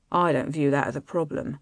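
low, mildly grumbling reply; grousing. A narrator's reading. Husband reluctant to join the guests, mild grousing. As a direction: grumble it low, a touch put out. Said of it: "he groused".

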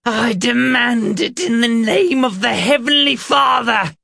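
high, loud fanatical demand; wrathful shriek. A narrator's reading. Reverend demands in God's name, shrieking with wrath. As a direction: scream it like a man possessed. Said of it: "his voice filled with wrath".

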